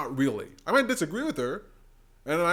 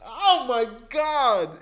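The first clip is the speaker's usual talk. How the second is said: 1 slow thrilled exclaim; 6 semitones higher, 4 dB louder, much slower than usual. Real, not acted. Thrilled reaction.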